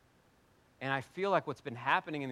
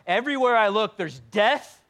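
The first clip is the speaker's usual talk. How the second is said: mock horror; 7 semitones higher, 10 dB louder than usual. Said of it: "You're like".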